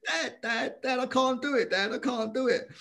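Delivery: high-pitched mock panicky boy; tearful pleading, imitated. Frightened young boxer begs his dad before the fight, acted tearful plea. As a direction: plead like a scared kid, acted out. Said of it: "I'm in tears. I'm, I'm like a wreck".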